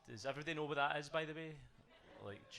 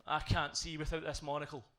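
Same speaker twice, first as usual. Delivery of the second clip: angry retort; 5 semitones higher, 9 dB louder than usual. Retold or imitated. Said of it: "I was like".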